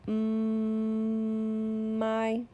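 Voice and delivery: "Mm" hummed long, then "my"; teacher blending "Mm, my" with the class; held sound. Shown as a demonstration. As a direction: hum the "Mm" long, then slide into "my". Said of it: "Get ready".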